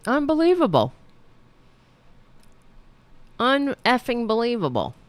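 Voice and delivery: high, exasperated disbelief; can't believe what he just heard; outraged disbelief. Real, not acted. Reacting to pardon promise.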